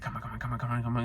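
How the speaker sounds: very soft, urgent come-ons; hushed willing him to succeed; rooting quietly. Retold or imitated. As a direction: cheer him on under your breath. Silently rooting for nervous guy.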